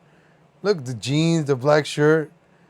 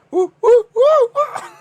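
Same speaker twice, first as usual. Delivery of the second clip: high mock woo; 9 dB louder, slower than usual. Imitating the sound of the clip.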